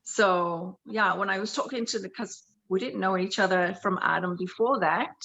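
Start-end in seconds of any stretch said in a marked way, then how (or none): none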